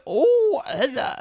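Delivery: high-pitched goofy greeting; funny voice. Retold or imitated. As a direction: try out a silly high character voice. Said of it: "orderly with a funny voice".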